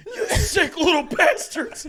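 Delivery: dad screaming at his sons; furious dad. Retold or imitated. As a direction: bellow at the kids in disgust. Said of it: "my dad's like".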